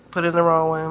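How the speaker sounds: ordinary talk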